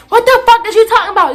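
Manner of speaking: high indignant retold yell. Student yelling at her accuser, high outraged holler. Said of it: "I'm sitting there and I'm yelling".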